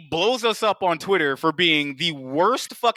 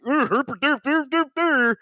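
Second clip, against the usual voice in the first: much higher, dopey put-on voice; dumb guy voice; 7 semitones higher than usual. Mocking themselves as dumb viewers, higher dopey voice. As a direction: play a clueless dumbass. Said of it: "our dumbasses would have been like".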